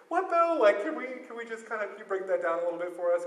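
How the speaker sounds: high, loud, disbelieving squeal; incredulous what. Retold or imitated. Disciple at dinner hearing Jesus say he is God, shrieking in disbelief. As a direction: pitch it way up in stunned disbelief.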